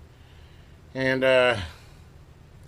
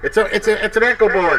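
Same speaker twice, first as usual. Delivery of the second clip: excited, high and loud; 10 semitones higher, 10 dB louder than usual. Real, not acted. Excited discovery of echo board.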